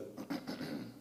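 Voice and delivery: a short, deliberate clearing of the throat. Real, not acted. Tenant pausing before naming his religion, clears throat. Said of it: "cleared my throat".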